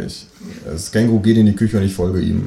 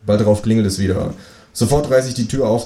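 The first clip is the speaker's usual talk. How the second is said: ordinary delivery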